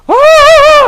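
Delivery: high, loud mocking shriek of alarm; mock freak-out yelp; mock panic scream. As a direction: shriek like your hair's on fire. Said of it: "your hair's on fire".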